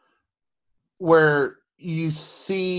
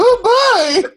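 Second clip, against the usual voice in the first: high, loud, hammed-up thrilled disbelief; mock giddy amazement; 9 dB louder than usual. Friend mimicking his first-touch reaction, mock thrilled. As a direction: play it as overjoyed disbelief. Playfully voices the thrilled boy.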